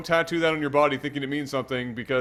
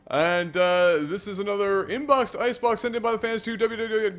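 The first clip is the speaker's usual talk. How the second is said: show announcer; 6 semitones higher than usual. Acted. Caster welcomes viewers to the show, announcer style. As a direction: open the cast like an announcer, high and projected.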